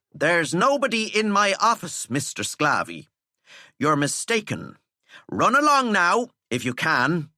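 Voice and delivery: teacher dismissing prefect; dismissive teacher. Acted. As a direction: shoo him away.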